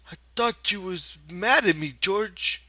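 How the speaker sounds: hurt Lenny. A narrator's reading. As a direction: ask it like a child afraid of a scolding. Lenny's voice in the story.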